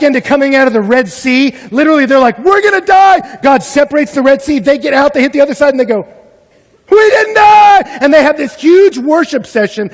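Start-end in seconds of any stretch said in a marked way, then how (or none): 2.4-3.4 high slow mock panicked shout. Israelites at the Red Sea, slow high panicked shout. 6.9-8.0 high, loud, drawn-out joyful shout; jubilant. Retold or imitated. Israelites safe across the sea, slow jubilant shout.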